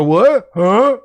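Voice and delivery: high, flustered what huh; guilty playing dumb. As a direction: act clueless to dodge blame. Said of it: "I go".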